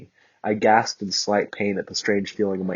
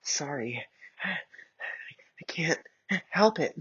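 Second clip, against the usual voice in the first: soft, high embarrassed whisper; shy girl apologizing to Bakugo; blushing; 8 semitones higher, 6 dB quieter, much slower than usual. Acted. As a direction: breathe it out shyly. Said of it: "I blushed".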